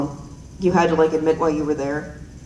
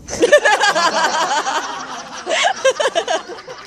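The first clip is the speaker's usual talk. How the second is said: long high rising laughter; 11 semitones higher than usual. Real, not acted. Long laugh after punchline.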